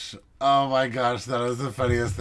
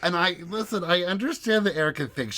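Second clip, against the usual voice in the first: ordinary delivery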